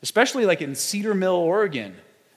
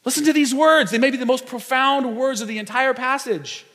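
preaching at full force; 7 semitones higher than usual. Acted. Preaching climax.

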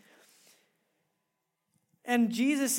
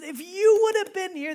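grieving reproach; 8 semitones higher, 8 dB louder than usual. Retold or imitated. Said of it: "Martha runs at Jesus and says".

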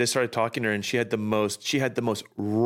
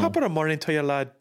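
playful Irish greeting, pitched up; Irish accent; 3 semitones higher, faster than usual. Pretend. Co-host shows off a silly voice for listeners, Irish accent. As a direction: put on a jokey Irish lilt. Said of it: "love to use our Irish accents".

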